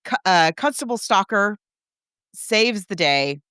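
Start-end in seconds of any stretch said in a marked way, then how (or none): none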